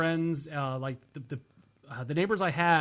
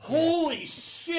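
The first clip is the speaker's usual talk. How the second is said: high, slow awestruck gasp; drawn-out high rapture over food; awed exclamation; 12 semitones higher, much slower than usual. Real, not acted. Ecstatic food memory.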